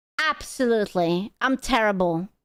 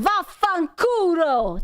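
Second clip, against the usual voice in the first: high, drawn-out Italian cursing; imitated TV Italian; 7 semitones higher, slower than usual. Imitating Italian woman on TV cursing everyone, drawn-out Italian. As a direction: belt it out slowly like an Italian on TV. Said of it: "this Italian hoe in the T V".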